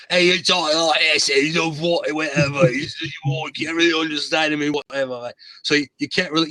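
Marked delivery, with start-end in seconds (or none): 0.1-5.1 slurred Cockney rocker impression; loose, mumbled London rocker voice. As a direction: garble it like a Cockney punk singer. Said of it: "So now [name]".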